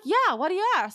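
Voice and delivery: high, clueless reply; dopey Spencer. Retold or imitated. Spencer answers Carly, high and oblivious. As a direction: answer brightly, no idea. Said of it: "He's like".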